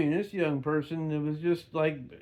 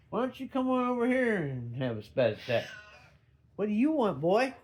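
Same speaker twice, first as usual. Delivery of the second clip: higher, teasing voice for the cat; playful pet talk; 5 semitones higher than usual. Real, not acted. Speaker coaxing the cat to come over and play, higher teasing pet voice. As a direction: goad the cat into a playful swat.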